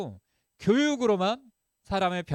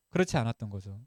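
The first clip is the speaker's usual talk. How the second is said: normal talking voice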